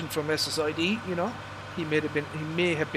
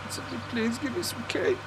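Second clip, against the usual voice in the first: high-pitched, hammed-up begging; put-on desperate plea; mock pleading; 9 semitones higher than usual. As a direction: beg playfully in a raised, plaintive voice. Fasting streamer begs for cake.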